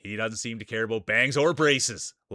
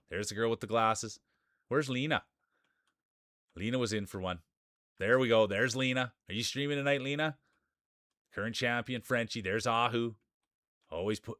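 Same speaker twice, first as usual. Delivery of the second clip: race announcer. Acted. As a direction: call the racers onto the track like a ringside announcer. Calls the marble race start.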